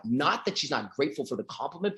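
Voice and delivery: ordinary delivery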